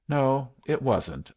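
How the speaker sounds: usual speaking voice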